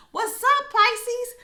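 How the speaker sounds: high, loud excited shout-out; hyped greeting. Real, not acted. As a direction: greet them like a party host.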